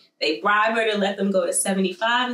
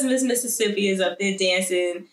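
ordinary delivery

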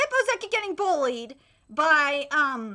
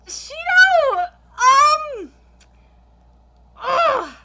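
dismayed cry; 7 semitones higher, 7 dB louder, much slower than usual. Real, not acted. Dismayed at character design.

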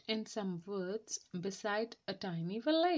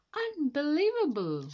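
higher, louder gasp of wonder; amazed; 7 semitones higher, 6 dB louder than usual. Acted. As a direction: marvel at it out loud. Amazed villagers at demo.

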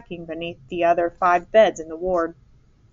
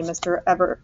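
ordinary delivery

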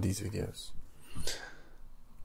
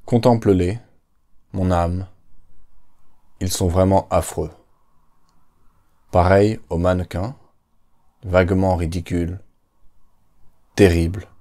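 poem recitation. Acted. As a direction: recite it like a solemn poem on a stage.

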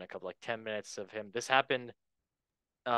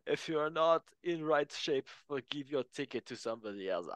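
put-on German accent; 3 semitones higher than usual. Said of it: "I'm gonna do a German accent".

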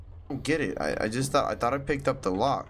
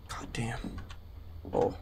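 whisper; 5 semitones lower, 9 dB quieter than usual. Real, not acted. Hiding from monster.

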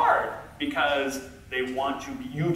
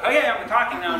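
higher, louder projected reply; retold raised voice; 7 semitones higher, 6 dB louder, faster than usual. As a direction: act out forcing your volume up. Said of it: "Talk louder".

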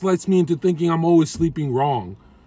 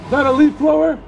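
raised voice, higher and louder; yelling over leaf blower; 11 semitones higher, 4 dB louder than usual. Real, not acted. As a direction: call out over the noise. Shouting to worker over blower.

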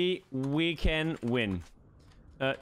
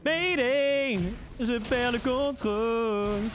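mock distress call; 5 semitones higher, slower than usual. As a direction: shout it like a pilot going down. Calling mayday in game panic. (appel de détresse crié, aigu et fort)

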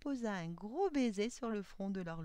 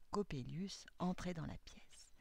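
usual speaking voice

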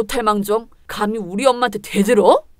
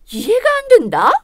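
higher, louder mocking question; scornful rising jab; 9 semitones higher, 4 dB louder than usual. Mother-in-law mocking her daughter-in-law's upbringing, scornful rising jab. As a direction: sneer the question up at the end.

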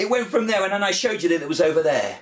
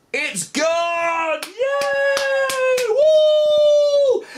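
very high, loud whoops; host cheering the vanished cube with the kids; 6 dB louder, much slower than usual. Pretend. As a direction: celebrate like a kids' show host. Magic trick success, cheering kids.

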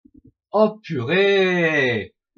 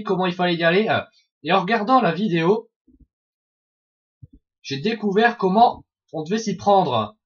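ordinary talk

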